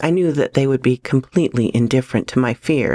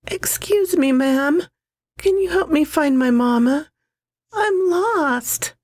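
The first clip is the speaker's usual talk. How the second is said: put-on small child voice; 13 semitones higher than usual. A narrator's reading. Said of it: "soft knock".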